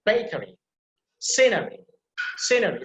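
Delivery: "bakery" and "scenery" with punched openings; stress on first syllable. Shown as a demonstration. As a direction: hit BA in "bakery", SCE in "scenery". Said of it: "stress will be on the first syllable".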